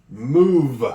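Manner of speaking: "Move" ending on a buzzing V; stressed V. Shown as a demonstration. Teacher stressing the V in "Move" for pupils. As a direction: buzz the V in "Move". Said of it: "I hear the V".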